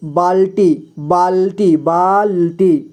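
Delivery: बाल्टी said slowly so the half ल is clearly heard; careful demonstration. Shown as a demonstration. Teacher asks students to listen closely, says बाल्टी slowly. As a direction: stretch out बाल्टी and linger on the half ल. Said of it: "सुनिए आप ध्यान से".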